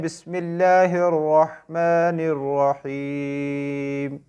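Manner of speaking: very slow, drawn-out recitation. Shown as a demonstration. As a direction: recite बिस्मिल्लाहिर्रहमानिर्रहीम ending on a stopped rahim. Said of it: "में को हम नहीं पढ़ते".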